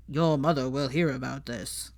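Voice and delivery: lightly voiced angry pureblood father, warning; angry Lucius Malfoy. A narrator's reading. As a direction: growl it like a cold, disapproving father. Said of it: "in a good impression of his angry Lucius Malfoy".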